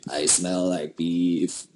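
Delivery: slow, low, put-on voice; lightly mimicked meme voice; vine quote impression. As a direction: drag out beef like the vine. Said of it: "I love that vine".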